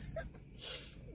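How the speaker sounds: high, teary laughing; breaking into laughing sobs. Real, not acted. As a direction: laugh through tears, slowly. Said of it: "I'm, like, in shambles".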